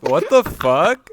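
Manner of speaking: loud, slow, laughing disbelief; shocked laughing disbelief. Real, not acted. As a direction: stretch it out, stunned and laughing. Hearing of hypnosis blackmail.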